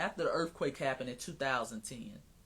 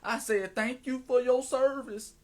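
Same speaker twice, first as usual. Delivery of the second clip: higher, louder mocking John Lewis voice; 4 semitones higher, 5 dB louder than usual. Mocking John Lewis's forgiveness to the audience, mock-pious. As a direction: mimic a forgiving churchgoer, pitched up and loud.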